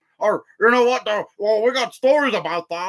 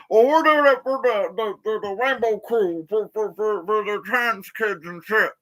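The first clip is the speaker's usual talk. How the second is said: mocking impression. Ranting vlogger mocks woke filmmakers in a put-on voice. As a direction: parody their excuse, stammering over the words. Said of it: "First you go and say".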